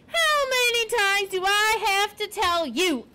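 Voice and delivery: high, exasperated, slowed-down yell; screaming. A narrator's reading. Porcupine fed up with repeating himself to Poppy, screaming. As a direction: read it as a drawn-out scream, pitched well up. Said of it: "Aerith screamed".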